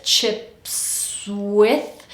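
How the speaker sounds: "chips with" stretched, s gliding into w; slow word transition. Shown as a demonstration. Coach goes from chips to with, slow linked demo. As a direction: draw out "chips with" through the s. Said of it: "Let's go from chips to with".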